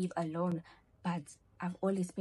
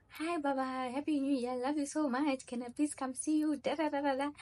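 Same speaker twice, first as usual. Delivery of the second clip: sweet mock boyfriend; 7 semitones higher than usual. Imitating boyfriend's sweet call.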